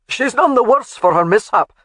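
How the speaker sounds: loud Scottish call to the men. A narrator's reading. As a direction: call it across the hill. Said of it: "he called out".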